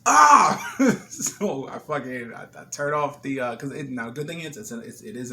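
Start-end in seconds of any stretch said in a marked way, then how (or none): 0.1-0.7 sudden high yelp of alarm; sharp panicked shriek; startled scream. Retold or imitated. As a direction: scream as the pan goes up in flames. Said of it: "So I'm like".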